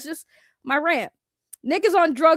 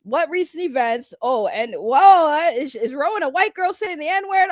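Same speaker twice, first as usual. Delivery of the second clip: ordinary delivery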